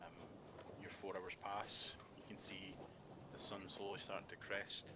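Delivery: soft, slowing scene narration; calm narration. A narrator's reading. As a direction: set the calm scene gently. GM narrates calm night watch.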